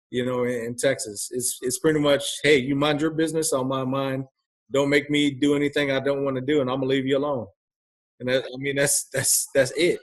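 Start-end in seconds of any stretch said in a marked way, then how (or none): none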